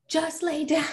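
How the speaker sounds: high, laughing bossy command; half-laughing impatient order; laughing mock order. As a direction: snap at the kid while laughing. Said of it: "rather than just saying".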